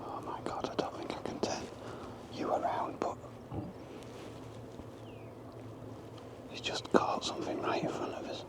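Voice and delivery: hushed whisper so owl stays; breathy whisper, barely voiced. Real, not acted. Quiet near owl.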